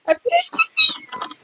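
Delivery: very high, drawn-out victory squeal; fangirl scream. Real, not acted. Player who finished the game, fangirl screaming. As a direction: squeal it out slowly at the top of your voice. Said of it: "I will scream like a freaking girl".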